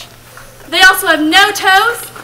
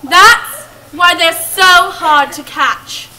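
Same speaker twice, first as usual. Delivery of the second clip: slow, ominous; 5 dB louder, slower than usual. Acted.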